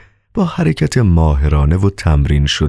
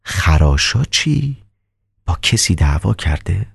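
calm doctor; slower than usual. Acted.

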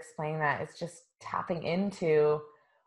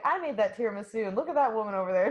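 excited chef quote; 5 semitones higher, much faster than usual. Pretend.

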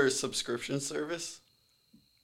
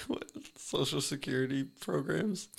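unvoiced, hushed question; whispering; 7 dB quieter than usual. Real, not acted. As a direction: whisper it sheepishly. Asks about welfare quietly.

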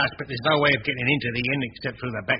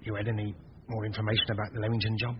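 low, much softer, secretive; hushed conspiring; 3 semitones lower, 6 dB quieter than usual. Acted. Innkeeper plotting with accomplice, hushed and secretive. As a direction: keep it down so nobody hears.

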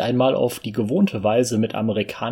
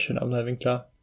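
ordinary delivery

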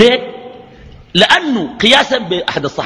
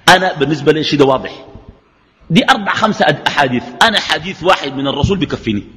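ordinary delivery

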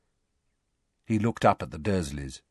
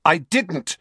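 said sharply; 8 semitones higher, 4 dB louder than usual. A narrator's reading. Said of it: "Harry said sharply".

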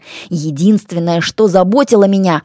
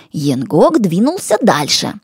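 ordinary delivery, nothing marked

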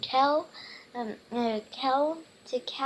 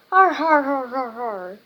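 loud, hearty "har, har" pirate laugh; storybook pirates' mock guffaw; pirate laughter; 8 dB louder than usual. A narrator's reading. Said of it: "the pirates laughed".

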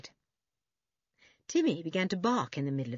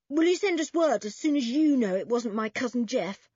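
put-on higher, louder forlorn boy's plea; 7 semitones higher, 5 dB louder than usual. A narrator's reading. Toby asking the policemen about his cousin, forlorn. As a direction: forlorn and urgent, pitched up. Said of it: "asked Toby forlornly".

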